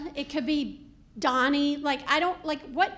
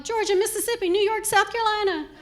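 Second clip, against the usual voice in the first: high, loud rattled-off list; family chiming in; 7 semitones higher, 6 dB louder, faster than usual. Acted. Family answering a newcomer, eagerly chiming in. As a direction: call out each place like a roll call.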